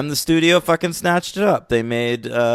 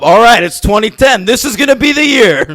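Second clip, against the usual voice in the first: high, loud mock pep talk; rally yourself with hammed-up resolve; psyching themselves up; 7 semitones higher, 5 dB louder than usual. Someone hyping themselves for the new year, mock psyched-up. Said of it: "like, psych themselves up".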